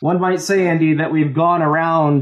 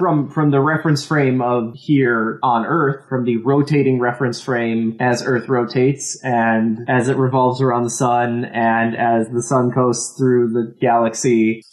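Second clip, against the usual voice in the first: ordinary talk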